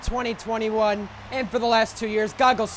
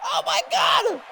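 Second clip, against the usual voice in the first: high shocked shout; excited shout; 12 semitones higher, slower than usual. Real, not acted. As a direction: yell it in disbelief. Shock at the race finish.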